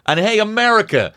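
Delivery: high, indignant mock scolding; mock rant. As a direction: yell at America like a know-it-all.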